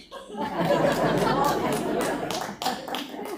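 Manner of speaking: breathy rising laugh; delighted laugh. Real, not acted. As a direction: laugh out loud with joy. Laughs after own line.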